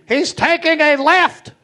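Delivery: high, slow announcing call; playful narration of a friend's walk; mock commentary. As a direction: call his moves like a guide. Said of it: "I'd be right behind him".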